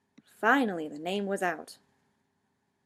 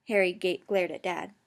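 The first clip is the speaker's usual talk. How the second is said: ordinary delivery, nothing marked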